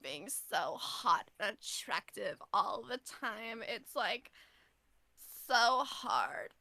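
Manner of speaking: put-on slow, airy Valley Girl brag fading to a whisper. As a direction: play a vain Valley Girl. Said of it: "It's my Valley Girl voice".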